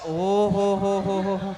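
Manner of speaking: low chain of oh-ho-ho; long low exclamation. Real, not acted. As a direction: let out a low, mocking oh-ho-ho. Exclaims at the arriving props.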